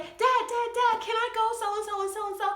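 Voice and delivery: put-on high, eager child calling Dad; pleading kid. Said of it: "So I'll be like".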